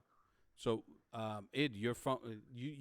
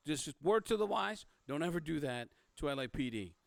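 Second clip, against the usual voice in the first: raised, emphatic cop's warning; stern warning; 7 semitones higher, 6 dB louder than usual. Acted. Cop warning an informant never to do that, stern and emphatic. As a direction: warn them off, louder and higher.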